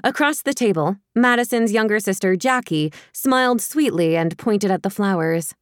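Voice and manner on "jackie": plain reading voice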